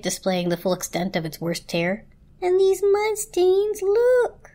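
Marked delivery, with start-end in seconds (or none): 2.4-4.5 very high, slow, pleading wail; crying blanket. A narrator's reading. Blanket showing its tear, high distraught cry. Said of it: "cried the blanket".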